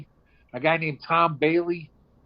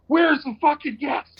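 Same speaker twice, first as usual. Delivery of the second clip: barge in bellowing for gas; shouted demand; 11 semitones higher than usual. Retold or imitated. Lost and out of gas, he yells at the diners.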